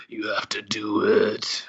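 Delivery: slow, menacing whisper in imitation; mock-villain whisper, drawn out. Villain back to his old self, slow menacing whisper. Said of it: "he's his menacing self again".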